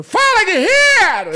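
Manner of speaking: loud, high drawn-out barracks shout; grito de sargento. Retold or imitated. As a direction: bark it like a drill sergeant greeting. Sargento gritando no quartel. (sargento berrando com o soldado)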